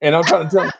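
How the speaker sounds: usual speaking voice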